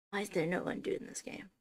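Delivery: soft exasperated whisper. Real, not acted. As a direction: whisper the complaint to yourself. Frustrated about no undo.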